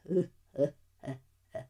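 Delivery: low, soft, fast sinister chuckle; low evil villain chuckle. Acted. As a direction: chuckle darkly inside the costume. Villain laughs in beetle costume.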